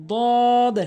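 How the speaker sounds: "দদ" long, tongue edge on upper gum; correct pronunciation. Shown as a demonstration. Teacher models the Arabic letter, correct long "দদ". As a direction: draw out "দদ" with a heavy first d. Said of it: "সঠিক উচ্চারণ".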